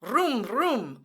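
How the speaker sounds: usual speaking voice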